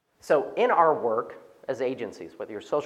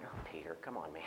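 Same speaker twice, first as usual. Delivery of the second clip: exasperated sigh; 10 semitones lower, 17 dB quieter than usual. Retold or imitated. Friends rolling eyes at Peter.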